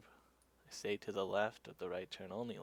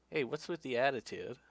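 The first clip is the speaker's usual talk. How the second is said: raised-pitch, louder mock challenge; confrontational cop; 4 semitones higher, 5 dB louder than usual. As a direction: imitate a cop calling out attitude. Cop confronting the cyclist, acted.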